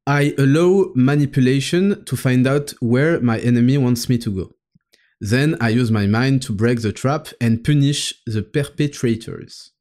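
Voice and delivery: English read with a French accent; careful French-accented English reading. Real, not acted. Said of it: "avec mon super accent".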